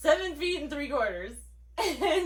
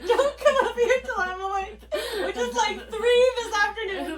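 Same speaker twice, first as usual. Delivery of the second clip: higher, louder, laughing through the words; 11 semitones higher, 8 dB louder than usual. Real, not acted. Sister tells about swatting her dog, laughing hard. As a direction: crack up while scolding the dog.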